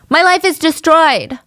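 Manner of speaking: a hammed-up cry of self-pity; imitating a wailing defendant. Defendant wailing at the court, mock anguish. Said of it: "And he goes".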